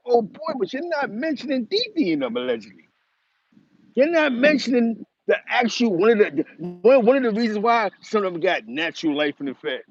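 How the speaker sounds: dismayed reaction. Retold or imitated. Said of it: "So I'm sitting here listening, going".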